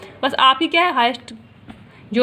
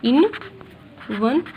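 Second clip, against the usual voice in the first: teacher dictating the model answer to students; slow dictation; 3 semitones lower, 7 dB quieter, much slower than usual. Shown as a demonstration. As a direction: answer dictated word by word with long gaps. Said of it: "मतलब power लिख दीजिए".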